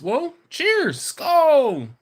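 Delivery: high, loud Viking toast; rousing toast. Shown as a demonstration. Streamer toasting viewers Viking-style, hearty shout. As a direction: raise the glass with a big holler.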